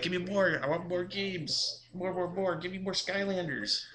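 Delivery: put-on whiny greedy kid. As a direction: beg like a spoiled kid who wants it all. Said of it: "And they'll be like".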